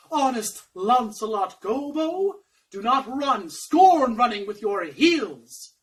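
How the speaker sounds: lightly voiced, theatrical caution; warning conscience. Conscience begs servant to stay, hammed-up mild warning. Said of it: "My conscience says to me".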